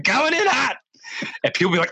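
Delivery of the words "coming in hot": high-pitched yell down the slope; yelled warning. Retold or imitated. Sliding hiker to people on the trail, yelling. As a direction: holler it high as a heads-up. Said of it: "sliding down this mountain yelling".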